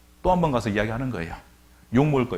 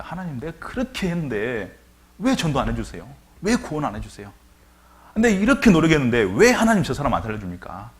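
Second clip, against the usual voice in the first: louder, building resentful plea; complaining to God; 4 semitones higher, 7 dB louder than usual. Retold or imitated. Believer complaining to God about unanswered prayer, building louder. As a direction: vent frustration at God. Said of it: "쉽게 원망할 때가 있습니다".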